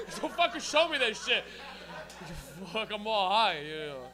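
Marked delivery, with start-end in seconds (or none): none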